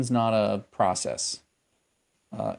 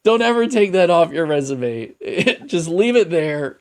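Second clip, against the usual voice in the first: emphatic amused plea; 7 semitones higher, 7 dB louder than usual. Real, not acted. Amused, emphatic plea over resume.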